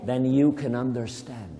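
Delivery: slow, lilting poem recital; gentle recitation of Yeats; poem reading. Acted. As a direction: read it like a spell, slowly. Teacher reads poem with child.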